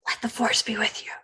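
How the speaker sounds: soft unvoiced whisper; hushed whisper to the agent. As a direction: breathe it like a blessing.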